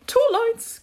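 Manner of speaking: very high, loud creature cry; high-pitched turtle shout; Mock Turtle crying out. A narrator's reading. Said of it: "cried the Mock Turtle".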